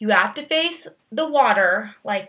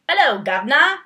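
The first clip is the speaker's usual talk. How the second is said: a weak stab at a Cockney greeting, a bit higher and louder; bad British accent; 4 semitones higher, 5 dB louder than usual. Shown as a demonstration. Showing she can't do accents: bad British accent. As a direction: try a British accent and miss. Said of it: "I'm not really good at accents".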